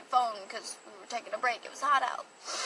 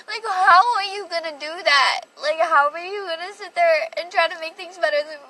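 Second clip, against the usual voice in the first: indignant; 4 semitones higher, 8 dB louder than usual. Real, not acted. Outraged at boyfriend's slap.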